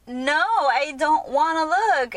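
high, loud, drawn-out protest; whiny retold refusal; emotional refusal. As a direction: plead it slowly, resisting. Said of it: "really emotional, almost gonna cry".

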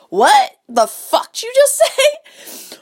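high, drawn-out disbelief breaking into a laugh; shocked, high-pitched and slow; incredulous reaction. Retold or imitated. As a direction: retell a stunned "did I hear that right", half laughing. Acted shocked reply to lyrics.

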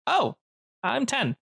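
put-on startled little boy asking again; ten-year-old kid. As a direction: caught off guard, apologetic kid. GM voicing the kid.

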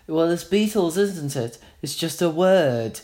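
a hint of a Liverpool accent, slightly put on; half-hearted go at a Liverpudlian accent. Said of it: "I can't do the accent".

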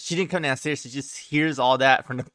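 normal talking voice

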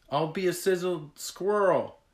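high, loud protest; worried cry. A narrator's reading. Skyla refuses to ride the flaming bird, worried cry. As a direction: protest in rising alarm. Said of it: "Skyla cried".

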